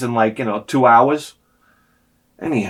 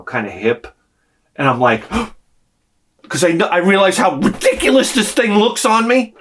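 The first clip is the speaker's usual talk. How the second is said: normal talking voice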